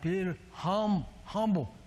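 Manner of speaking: slow, halting imitation of stuck speech; struggling to talk. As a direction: drag out the words as if the mouth won't cooperate. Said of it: "But I was like".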